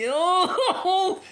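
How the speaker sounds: high, loud laugh. Real, not acted. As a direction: burst out laughing. Laughing at anthem joke.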